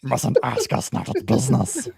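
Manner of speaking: loud, strangled creature voice; loud hammed-up creature impression; Smeagol. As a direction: put on a scheming cave creature. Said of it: "It's almost like Smeagol-ish".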